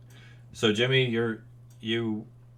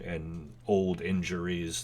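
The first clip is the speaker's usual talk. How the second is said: ordinary delivery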